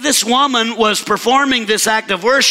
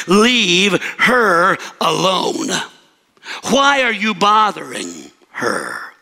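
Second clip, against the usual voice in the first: slow, weighty rebuke; firm correction; 3 semitones lower, slower than usual. Acted. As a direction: correct them firmly, word by word. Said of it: "we hear the correction from Jesus".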